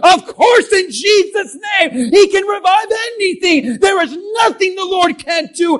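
hammed-up churchy optimism, all shouts; hyped optimist. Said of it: "we gotta say".

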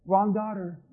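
understated, muted "Wrong daughter"; low-key objection. Acted. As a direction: murmur "Wrong daughter" as a held-back correction. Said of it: "Jairus is like".

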